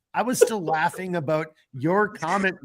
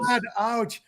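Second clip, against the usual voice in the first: real ache after laughing, slightly higher; hurting from laughter; 5 semitones higher, 4 dB quieter than usual. Real, not acted. Host aching from laughing at the guests, pained. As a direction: wince out the words after a laughing fit. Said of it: "fuck, it hurts".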